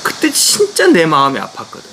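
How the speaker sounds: ordinary talk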